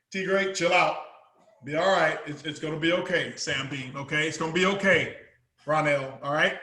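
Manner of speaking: low, soft calming voice; reassuring. Acted. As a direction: settle them down quietly, no worries. Calming friends before the game.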